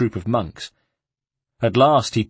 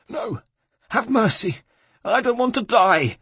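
high-pitched begging for mercy; terrified burbling; 13 semitones higher than usual. A narrator's reading. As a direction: whimper for your life, pitched way up. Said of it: "he burbled".

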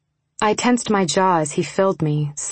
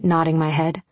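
ordinary delivery

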